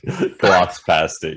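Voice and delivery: ordinary talk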